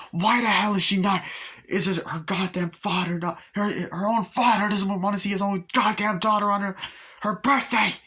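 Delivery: mother raging at her ex-husband, imitated high shouting; mock angry rant. Mother ranting on the phone, retold.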